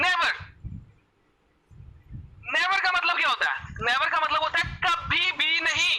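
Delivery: "Never" and "कभी भी नहीं" pitched high and emphatic; emphatic stress on never. Real, not acted. Host hammers home the word never. ("Never" पर ऊँचे सुर में ज़ोर)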